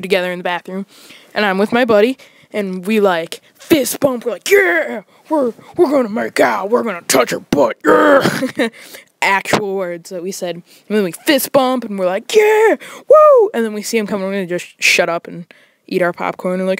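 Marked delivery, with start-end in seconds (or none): 4.5-8.7 high, pumped-up bro chant; bro hype. Retold or imitated. Two buddies bragging before the date, pumped high. 12.3-13.5 high, loud whoop; whooping cheer. Retold or imitated. Buddies fist bumping, loud high whoop.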